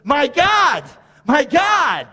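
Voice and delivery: high, loud cry to God; impassioned cry. Acted. Preacher leading congregation in Jesus' cry under sin, impassioned cry. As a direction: cry it out with full feeling. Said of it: "I want you to feel it".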